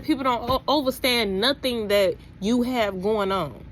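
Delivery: ordinary delivery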